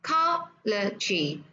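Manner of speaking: Cology very slow, COL-o-gy; Cology spaced out, stressed on COL; last three syllables. Shown as a demonstration. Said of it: "là ba âm còn lại".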